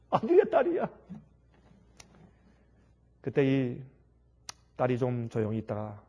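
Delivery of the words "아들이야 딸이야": laughing question. Acted. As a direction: ask 아들이야, 딸이야 with a laugh, high.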